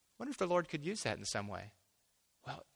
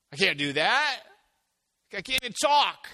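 higher, louder, anxious pleading; panicked protest; 7 semitones higher, 10 dB louder than usual. Retold or imitated. Moses protesting to God, alarmed and pleading. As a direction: push back like you're cornered.

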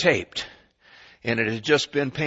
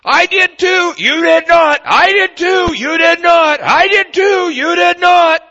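put-on shrill high bickering kids, shouted much louder and much higher; squabbling kids; 12 dB louder, slower than usual. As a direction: play two kids yelling back and forth at each other. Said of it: "heard your kids do this".

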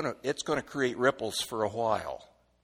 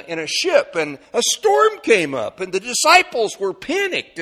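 high, energetic sermon storytelling; animated preaching; 8 semitones higher, 7 dB louder than usual. Acted. Preacher telling the congregation of the storm at sea, high and impassioned.

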